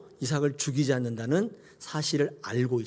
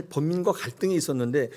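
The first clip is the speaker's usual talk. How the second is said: normal talking voice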